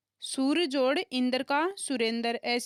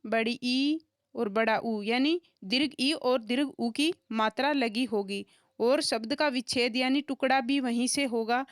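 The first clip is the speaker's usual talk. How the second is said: ordinary talk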